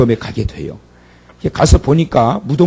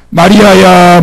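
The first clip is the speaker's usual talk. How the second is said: higher, louder ringing call of 마리아야; 5 semitones higher, 10 dB louder, much slower than usual. Acted. Jesus calls Mary's name, loud and higher. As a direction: call 마리아야 out so it carries far.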